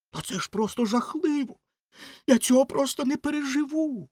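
high-pitched rocket working up to a lament; hammed-up high wailing grief; melodramatic Rocket.

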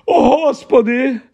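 high frightened exclamation; alarmed. Acted. Aunt exclaiming in alarm over the letter, high fright. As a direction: gasp it out in fright. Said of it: "вона хапнула ротом повітря".

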